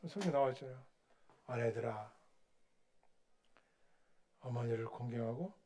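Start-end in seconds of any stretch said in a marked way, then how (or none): none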